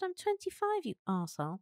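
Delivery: soft mock-indignant comeback, starting high; retold retort. Woman replaying her retort to the boss, soft mock indignation. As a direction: squeak the protest, then drop for the insult. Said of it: "I said".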